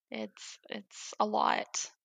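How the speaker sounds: slow, soft and halting, with a small laugh; quiet, overwhelmed. Real, not acted. Mother recalling her son's traumatic birth, slow and soft. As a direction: let the words fall away slowly, half laughing.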